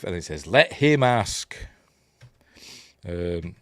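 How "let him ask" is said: drawn-out, raised mock pronouncement; mock film character. Quoting the film's reply, drawn-out mock pronouncement. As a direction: stretch it into a grand pronouncement.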